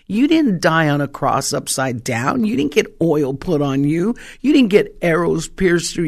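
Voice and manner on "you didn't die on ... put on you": normal talking voice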